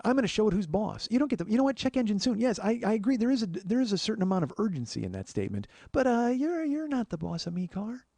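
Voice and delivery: defiant. Retold or imitated. Defiant address to the car.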